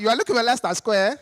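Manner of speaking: mock Nigerian tourist voice; mimicked Nigerian accent. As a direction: question back in exaggerated Nigerian English. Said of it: "I couldn't resist".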